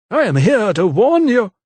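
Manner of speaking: a preacher's dire warning; ominous vicar. A narrator's reading. Vicar warning the lady, ominous.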